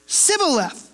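Sibboleth with a plain S instead of SH at the start; mispronounced Sibboleth. Retold or imitated. Soldier failing the password at the river, says Sibboleth. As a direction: say "Sibboleth" hissing an S where the SH belongs. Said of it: "for he could not pronounce it right".

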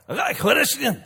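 high, loud mock Scottish brogue; hammed-up Scottish punk accent. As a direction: put on a thick Scottish brogue. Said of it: "When he was more like".

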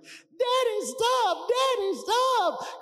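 high-pitched hammed-up shriek, drawn out; mock-hysterical squeal; panicked sisters. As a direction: mimic frantic kid sisters screeching for Dad to stop. Acts out sisters' panicked pleading.

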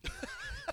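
bursting out laughing; big high laugh; loud laugh. Real, not acted. Laughing at forty-ounce joke.

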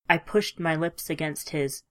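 plain reading voice

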